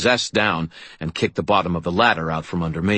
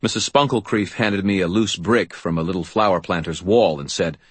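ordinary delivery

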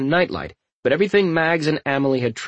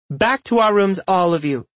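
raised-pitch motherly command; firm voice; 7 semitones higher, 3 dB louder than usual. A narrator's reading. Mother sending the children to their rooms, firm. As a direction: order them along firmly, pitched up. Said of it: "Emily said in a firm voice".

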